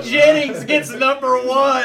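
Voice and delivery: slow, high, loud bragging announcement; gloating announcement. Real, not acted. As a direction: crow about the win slowly. Announces his lucky draw.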